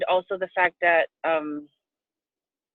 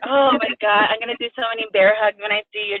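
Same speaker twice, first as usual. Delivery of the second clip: ordinary talk, nothing marked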